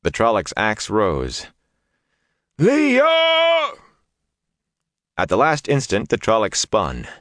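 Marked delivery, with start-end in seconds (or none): 2.6-3.7 desperate high scream across the fight; screaming a name. A narrator's reading. Perrin screaming for Leah mid-battle, desperate high cry. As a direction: scream her name in terror.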